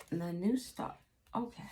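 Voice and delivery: ordinary talk, nothing marked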